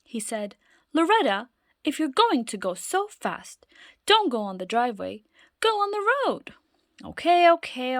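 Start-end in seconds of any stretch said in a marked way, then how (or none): 0.9-6.5 raised, higher voice warning his daughter; stern warning. Acted. Father warning daughter about speed. 7.0-8.0 put-on high, louder childish "okay"; impatient child. Loretta brushing off her father, high and impatient.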